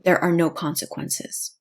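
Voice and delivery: usual speaking voice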